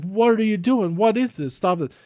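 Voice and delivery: lightly acted, high-pitched alarmed questions; alarmed protest. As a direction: imitate someone demanding an explanation. Retells wrestler confronting brother in distress.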